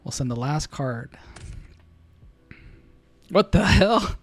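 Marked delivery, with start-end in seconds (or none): 3.3-4.1 loud, high burst of disbelief; what the hell, blurted loud and high; shocked shout. Real, not acted. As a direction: yell it in stunned shock. Shocked at ripped card.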